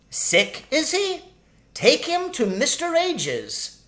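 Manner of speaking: a tiny sharp-tongued shrew, pitched high; sharp lady shrew. Acted. Shrew asks about Timothy.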